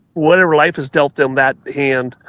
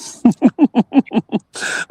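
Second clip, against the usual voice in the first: laughing; 8 semitones higher than usual. Real, not acted. Laugh at own joke.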